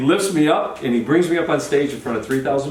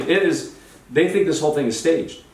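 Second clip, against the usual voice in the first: usual speaking voice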